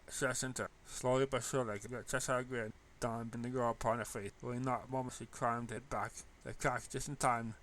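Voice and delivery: ordinary delivery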